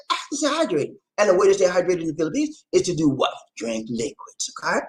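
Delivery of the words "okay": ordinary talk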